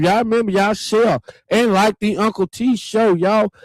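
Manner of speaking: high, lifted plug for the show; excited call-out. Real, not acted. Host urging viewers to share the show, excited and high. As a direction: pitch it up to rally the viewers.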